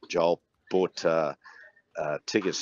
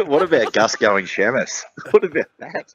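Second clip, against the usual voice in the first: loud, higher, through real laughter; laughing; 5 semitones higher, 5 dB louder than usual. Real, not acted. Co-host cracking up over Gus going Shamus, laughing. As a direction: get the question out while laughing.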